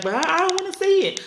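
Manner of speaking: put-on high childish protest; daughter's protest. Daughter telling mom she doesn't want to see, high. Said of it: "She like".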